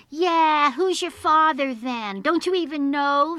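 put-on loud, high jeering children; loud mocking challenge; teasing taunt. A narrator's reading. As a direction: taunt him across the playground. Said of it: "they teased him".

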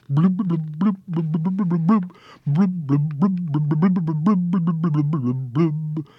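rhythmic lip-popping blub blub; bubbling sound. A narrator's reading. Brook bubbling into the store, steady blub sounds.

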